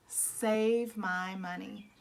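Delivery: usual speaking voice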